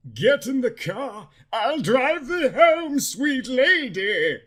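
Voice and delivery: put-on slow gallant old-world charm; old-fashioned speech. Edward inviting the girl into his car, courtly. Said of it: "in his beautiful old-fashioned speech".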